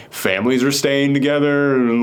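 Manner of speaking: ordinary talk, nothing marked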